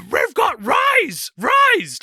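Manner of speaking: very high, slow shouted cartoon dog voice, rolling the R; Scooby-Doo voice. Retelling the film to co-host, shouted Scooby impression. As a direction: yelp it out like a scared cartoon dog. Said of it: "like, trying to say eyes".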